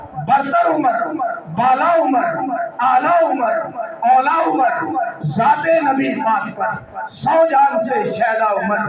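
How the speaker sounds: preacher reciting praise to a crowd; rousing praise verse. Acted. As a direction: declaim each title in a separate forceful burst.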